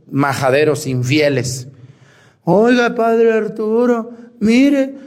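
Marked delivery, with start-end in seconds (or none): 2.4-4.9 high, drawn-out plea to a priest; near-tearful caller. Retold or imitated. Unfaithful husband appeals to Padre Arturo, mock near-tearful. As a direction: imitate a man calling out, almost in tears. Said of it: "Me mandó un audio casi llorando el señor".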